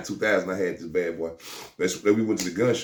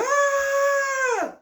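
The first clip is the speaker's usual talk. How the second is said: very high mock scream; woman screaming at the gunshot; imitated scream. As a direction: shriek it like a startled woman. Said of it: "the chick screaming".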